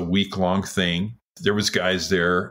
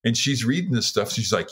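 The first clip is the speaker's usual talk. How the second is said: ordinary talk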